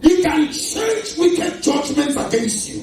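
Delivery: loud, slow declaration; drawn-out, emphatic pulpit delivery; forceful preaching. Real, not acted. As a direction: proclaim each word with weight. Preacher proclaims to congregation.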